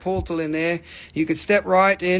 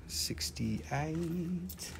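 a breathy whispered price; 14 dB quieter than usual. Real, not acted. Host whispering the price to the viewers. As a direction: hush it like a secret.

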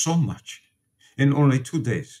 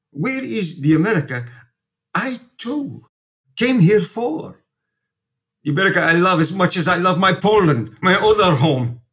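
rising to a louder, higher outburst; impassioned plea; 6 semitones higher, 4 dB louder than usual. Acted. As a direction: plead with mounting anger for the poor widow. Priest defends widow to detective.

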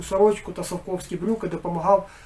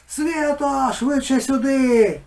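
high, loud, slow urgent yell; father yelling for Sviata to hurry over; 7 semitones higher, 6 dB louder, much slower than usual. A narrator's reading. Father calling from the next room, loud slow yell. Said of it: "репетував батько".

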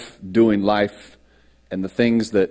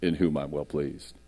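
voice of God; 4 semitones lower, 5 dB louder, slower than usual. Acted. Heavens open over the baptism, booming divine proclamation. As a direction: thunder the opening, end low and solemn. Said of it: "this voice comes out and says".

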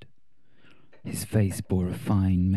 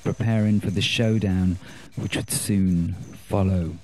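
ordinary delivery, nothing marked

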